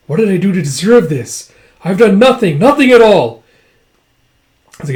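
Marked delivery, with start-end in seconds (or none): 0.1-3.4 building to a high, loud outcry; acted anguished protest. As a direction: protest innocence, growing more desperate. Man protests his unjust punishment.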